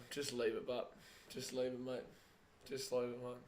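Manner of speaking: quiet aside. Real, not acted. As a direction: say it softly, under the commentary. Quietly telling someone to leave it.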